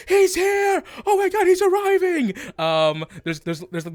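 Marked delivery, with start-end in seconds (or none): 0.1-2.3 high, excited shouting; loud crowd voice. Acted. Game master as crowd awaiting an arrival, shouting high. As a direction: shout with mounting tension over the crowd. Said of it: "this loud, like, voice".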